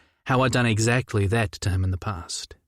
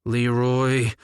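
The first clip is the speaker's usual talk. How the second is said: growled name. Acted. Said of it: "Judah practically growled my name".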